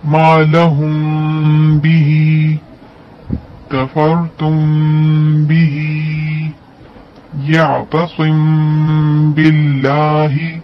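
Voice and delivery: recited slowly, lips slightly open on म before ब; slow Quranic recitation, hiding the म; ikhfa shafawi. Shown as a demonstration. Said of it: "हल्का सा इतना खुला रखेंगे".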